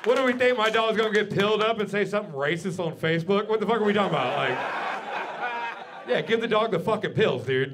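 loud, high, laughing tirade; exasperated rant. Acted. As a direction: yell it at the crowd in mock outrage. Comic rant about the pills.

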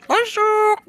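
squeaky greeting. Pretend. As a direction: squeak a cheeky hello. Greeting game monster.

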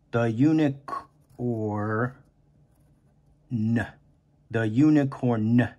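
The unicorn drawn out slowly, each sound held; slow teacher sounding out unicorn; stretched-out word. Shown as a demonstration. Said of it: "we need to stretch out the word unicorn".

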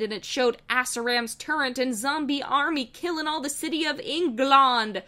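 usual speaking voice

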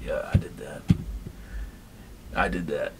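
low, soft, hushed villain line; hint of a menacing villain. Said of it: "a little more grumbly".

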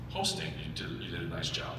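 ordinary delivery

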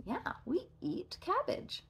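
soft whispered cabbage. Real, not acted. Teacher whispers to the child. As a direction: hush it out.

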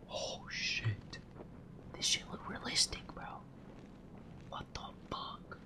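hushed unvoiced whisper. Real, not acted. Streamer whispering so the game won't hear. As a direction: keep it to a breath. Whispering to keep mic low.

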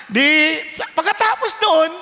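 ordinary talk, nothing marked

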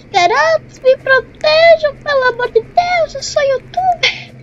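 high pleading cry for help. Real, not acted. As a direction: beg them to protect you. Begging kids for protection.

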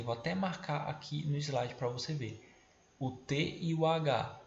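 ordinary talk

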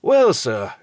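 cocky servant's opener; triumphant. A narrator's reading. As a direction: crow that he was right. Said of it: "continued Robert triumphantly".

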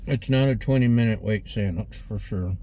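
speaking between bites; chewing while talking. Real, not acted. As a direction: mouth partly full. Seen 0: Bit sandwich earlier; jaw and lips look mid-chew while talking.

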